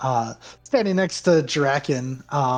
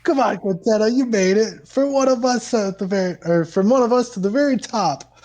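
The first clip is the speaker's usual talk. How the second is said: excited crowd; 8 semitones higher, 4 dB louder than usual. Acted. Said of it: "everybody's like".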